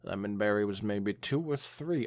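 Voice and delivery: usual speaking voice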